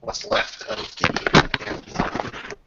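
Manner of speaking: usual speaking voice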